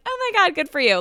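high-pitched imitated praise; congratulating mom.

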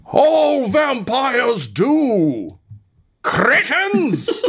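high, slow, booming voice from above; booming mage. Acted. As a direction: thunder it down in contempt. Mage roars back at party.

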